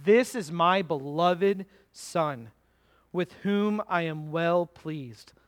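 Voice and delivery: slow, weighty, every word set apart; grand, unhurried proclamation; slow solemn voice of God. Acted. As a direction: proclaim it slowly like a voice from heaven. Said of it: "And a voice from the cloud said".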